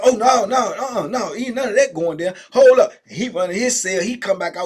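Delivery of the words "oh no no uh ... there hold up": loud, high call to halt; commanding stop. Retold or imitated. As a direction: hold the youngsters back. Old head stops the youngsters.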